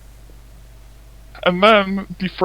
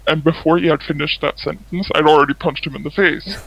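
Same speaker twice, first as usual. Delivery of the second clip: ordinary delivery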